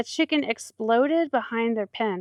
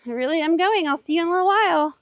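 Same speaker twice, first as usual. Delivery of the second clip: higher, louder and quicker; insistent goodbye; 6 semitones higher, 4 dB louder, faster than usual. Real, not acted. Telling her animals goodbye, higher and louder. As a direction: press it, for the last time. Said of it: "for the last time".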